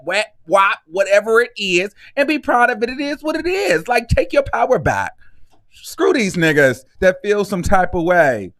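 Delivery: normal talking voice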